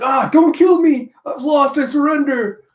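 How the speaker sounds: high, loud frightened pleading; panicked begging. Acted. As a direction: beg for your life, terrified. Beaten character begs for life.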